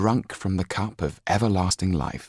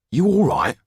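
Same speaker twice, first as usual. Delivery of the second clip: a lightly voiced caretaker, high and louder; caretaker's question; 12 semitones higher, 7 dB louder than usual. A narrator's reading. Caretaker checking on the boy, lightly voiced and raised. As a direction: ask the boy if he's all right.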